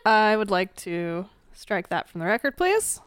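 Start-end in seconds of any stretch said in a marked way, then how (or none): none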